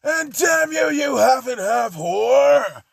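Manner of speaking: high, loud furious yell; a loud, raging yell; furious shout. Acted. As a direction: scream it at your rivals. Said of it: "Bakugo saying".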